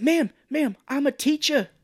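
high, loud mock pleading; earnest actor imitation, hammed up; Mark Wahlberg. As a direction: call it out high, begging to be believed. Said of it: "he's like".

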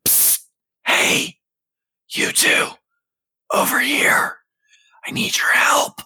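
whispering. Acted. Stranger calls from dark alley.

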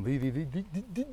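"the" climbing, th turning into d; TH hardening to D. Shown as a demonstration. Tracing the road to Sheffield, "the" hardens to "dee". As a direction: harden each "the" towards "dee", rising. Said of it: "and it gets harder".

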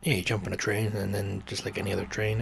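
usual speaking voice

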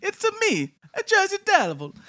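put-on hammy high Italian accent, like Mario; Mario-style Italian accent, loud and high; bad Italian accent. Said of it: "really bad Italian accent".

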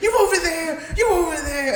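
high-pitched mock principal breaking up a fight; principal intervening. Principal breaking up a students' fight, hammed-up high voice.

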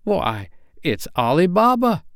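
high-pitched burst of delight; ecstatic exclamation. A narrator's reading. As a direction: Scrooge crying out in pure joy. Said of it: "Scrooge exclaimed in ecstasy".

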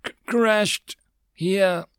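halting, high strained reply; stammer, then pronounce each word carefully; weakly spacing out each word; slow, careful. A narrator's reading. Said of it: "replied slowly, pronouncing each word carefully".

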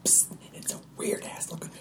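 hushed scout's whisper, close to laughing; in-game whisper. Pretend. Scout reporting a weird creature to companion, amused whisper. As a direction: whisper back what you have spotted.

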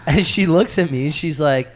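normal talking voice

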